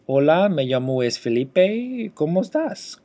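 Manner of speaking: loud Spanish phrases for dictation; Spanish dictation demo. Shown as a demonstration. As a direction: speak Spanish loudly with a lifted, questioning end. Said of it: "by dictating".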